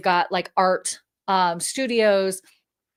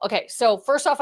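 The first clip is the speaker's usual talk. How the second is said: normal talking voice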